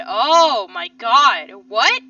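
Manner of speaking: drawn-out, very loud cry of disbelief; loud shock. Real, not acted. Player reacting to news that Mari's dead, loud shock. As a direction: blurt it out loud, stunned.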